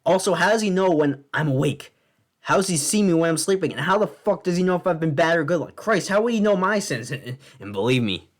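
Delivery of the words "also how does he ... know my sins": higher-pitched, incredulous mock outrage; indignant rant. Comedian demanding how Santa knows everything, indignant rant. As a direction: fire off questions in exasperated disbelief.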